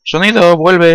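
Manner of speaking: high-pitched pleading call; playful plaintive calling; mock plea. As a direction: beg for it, drawn out and high. Calls the sound to return.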